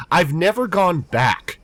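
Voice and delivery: usual speaking voice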